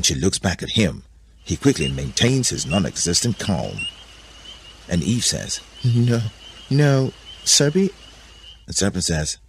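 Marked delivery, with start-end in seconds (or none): 5.8-7.9 high, drawn-out refusal; coy Eve. A narrator's reading. Eve answering Serpent, high drawn-out coyness. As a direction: stretch it out high and a little loud.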